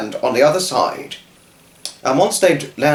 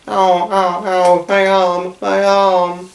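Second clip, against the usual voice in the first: high, slow, louder moaning; sad moan; 7 semitones higher, 3 dB louder, slower than usual.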